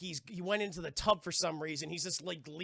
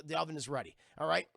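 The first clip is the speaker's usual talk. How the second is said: ordinary talk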